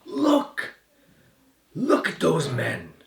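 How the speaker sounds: high-pitched outcry, dropping on the second call; shrieking shout. A narrator's reading. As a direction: shriek it as an accuser calling from behind. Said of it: "a shrieking voice shouts from behind".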